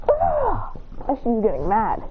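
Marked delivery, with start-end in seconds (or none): 0.1-0.7 high sharp cry of annoyance; frustrated yelp. Retold or imitated. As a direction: let out a high irritated ah. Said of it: "She goes".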